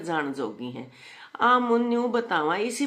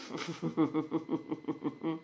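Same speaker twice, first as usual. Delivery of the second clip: soft, low staccato chuckle; quiet rolling laugh; 3 semitones lower, 8 dB quieter than usual. Real, not acted. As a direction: chuckle quietly through a run of short pulses. Laughs at the comment.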